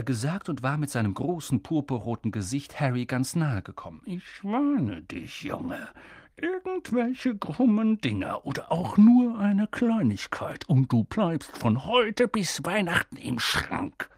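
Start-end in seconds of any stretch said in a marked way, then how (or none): 4.0-14.1 slow menacing warning; menacing uncle, close up; threatening uncle. A narrator's reading. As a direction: lean in and threaten. Vernon threatening Harry.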